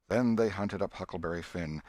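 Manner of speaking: plain reading voice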